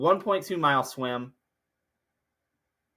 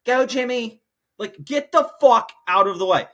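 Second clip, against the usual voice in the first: exasperated yell; 7 semitones higher, 7 dB louder than usual. Retold or imitated. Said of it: "I'm like".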